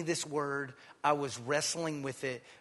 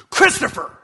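a sharp, higher call of his name; father calling his son; 13 semitones higher, 14 dB louder than usual. Retold or imitated. Father suddenly turns to his son Christopher, calling out. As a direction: call the boy's name, urgent.

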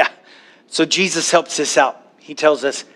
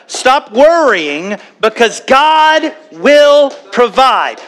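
raised, higher and drawn out with force; preached louder and slower; 7 semitones higher, 4 dB louder, slower than usual. Shown as a demonstration. Preacher repeats his promise to the church, louder and slower. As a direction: press it home to the congregation. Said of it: "I'm gonna say it one more time".

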